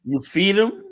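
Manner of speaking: high, disbelieving question from the woman; incredulous question. Retold or imitated. Woman asks narrator about his dog, high and incredulous.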